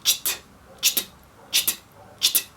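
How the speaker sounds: "tcht" as a whispered, voiceless burst; a voiceless "tcht", repeated; isolated tcht sound. Shown as a demonstration. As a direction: crack "tcht" out like a whip. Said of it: "Com esse som de tcht".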